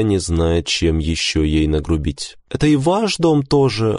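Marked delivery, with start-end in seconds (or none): 2.5-4.0 high woman's character voice; composed wife. A narrator's reading. Wife telling husband it's his home, composed. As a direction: answer him in a high, composed voice.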